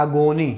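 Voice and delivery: 'एगोनी' with a full 'go' in the middle; 'एगोनी' read as 'a-go-nee'; Haryanvi pronunciation. Retold or imitated. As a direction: imitate Haryanvi 'एगोनी', long o. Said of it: "mother tongue Haryanvi होता है".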